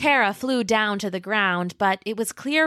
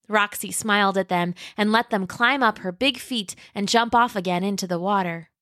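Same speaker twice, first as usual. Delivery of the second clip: plain reading voice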